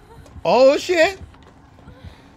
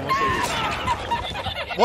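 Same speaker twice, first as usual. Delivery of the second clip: laughing squeal; much slower than usual. Pretend. Repeats rescue promise in funny voice.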